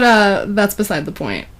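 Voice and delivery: ordinary talk, nothing marked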